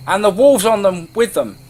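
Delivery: loud, high cry of warning; alarmed shout. A narrator's reading. Resident raising the alarm about wolves, alarmed shout. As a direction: call the danger out across town. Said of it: "with the shouts".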